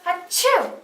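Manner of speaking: loud high sneeze; big exaggerated sneeze. Fake sneeze before tissue.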